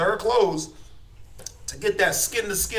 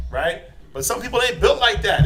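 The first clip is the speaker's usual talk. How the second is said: normal talking voice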